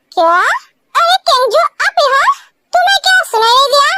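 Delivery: ordinary talk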